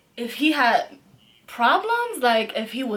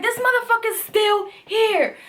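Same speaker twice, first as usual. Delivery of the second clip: retold yell; 10 semitones higher, 4 dB louder than usual. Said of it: "She screamed so fucking loud".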